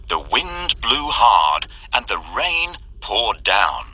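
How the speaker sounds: ordinary delivery, nothing marked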